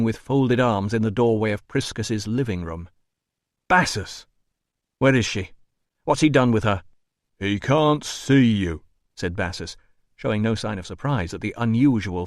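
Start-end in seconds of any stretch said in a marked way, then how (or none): 3.7-6.8 raised urgent voice; urgent demand. Acted. Rousseau bursting in demanding. 7.4-8.8 gruff doorman. Acted. Bassus, slow deep doorman voice.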